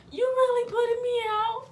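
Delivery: high, loud mock-hurt question; disbelieving. Daughter being thrown out by mom, disbelieving. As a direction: plead it in exaggerated disbelief.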